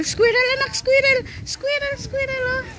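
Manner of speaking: excited mom spots a squirrel; excited high calling. Real, not acted. Spots squirrel, calls child.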